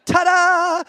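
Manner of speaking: high, loud showman's ta-da; mock fanfare. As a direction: present it like a magic trick. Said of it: "and I'm like".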